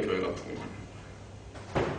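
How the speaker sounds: slow, whispered echo; hushed repeat. Real, not acted. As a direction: let it fall to a slow whisper. Repeats the point under breath. (속삭이듯 작게 천천히 되뇌며)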